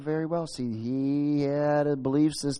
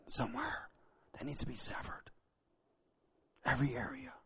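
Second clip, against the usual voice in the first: whispering; 15 dB quieter than usual. Real, not acted. Drops to intimate whisper.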